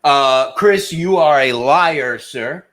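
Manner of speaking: loud, slow accusation; calling him a liar. Real, not acted. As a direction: call him out, dead serious. Calling out a podcast guest.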